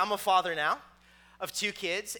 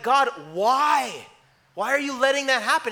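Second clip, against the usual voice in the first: exasperated plea; 3 semitones higher, 6 dB louder than usual. Acted. Acted complaint to God.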